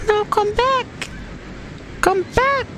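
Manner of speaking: very high pleading cry; squeaky high call. Acted. Calling after leaving character.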